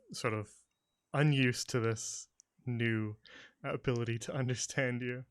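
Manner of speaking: ordinary talk, nothing marked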